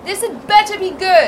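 loud, impatient and annoyed; irritated. Acted. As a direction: snap it out, fed up. Annoyed at being dragged out.

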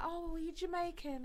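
high-pitched imitation of curious questioners.

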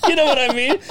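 high laughing burst. Real, not acted. After joking about his mom, laughing hard. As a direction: cracks up at own joke.

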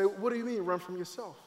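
soft, dropped-away question; puzzled aside. Acted. Audience questioning the speaker's claim, quiet aside. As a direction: ask it quietly, as the listener would.

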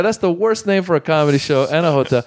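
high hammed-up complaint; mock outrage. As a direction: play it up as a comic grievance. Retold stage joke.

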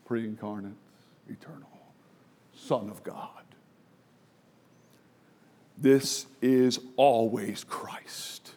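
whispered low, slow and reverent; awed whisper. Real, not acted. Preacher telling the crowd of Christ, awed whisper. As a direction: breathe it out in awe.

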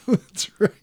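talking through real laughter; breathy laugh over the words; giggling at the joke. Real, not acted. Laughing at the shoe-wheel joke.

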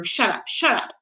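agitated villain's command; frantic silencing. A narrator's reading. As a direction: cut them off in alarm. Rat frantically silencing the princess.

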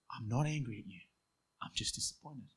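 dropping to a near whisper on disappointed; calm disappointed dad. Retold or imitated. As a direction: let it fade to a hushed disappointment. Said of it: "In a calm voice that just leveled you".

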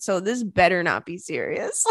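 ordinary talk, nothing marked

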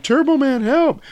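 very high mock child voice; hammed-up little-kid plea; imitated kid's cry for help. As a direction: squeal for rescue in a put-on kid voice. Falling kid calls for help.

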